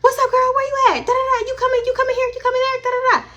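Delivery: put-on high, breezy party-girl voice; carefree friend voice. Tipsy friend answering the phone, high and breezy. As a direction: chirp it like she's having fun. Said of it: "She answers the phone".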